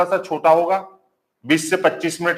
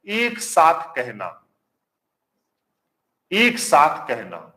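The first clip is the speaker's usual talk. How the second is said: slow dictation; 3 semitones lower, much slower than usual. Shown as a demonstration. Teacher dictating a meaning.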